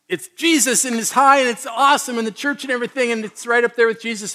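high-pitched, upbeat rush; excited high point. Shown as a demonstration. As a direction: gush about being right at the top. Acting out the roller coaster high.

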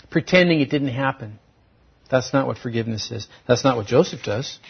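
normal talking voice

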